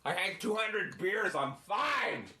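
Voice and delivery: put-on high shouted drunk boast; belligerent drunk. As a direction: yell like a happy drunk. Mocking a drunk.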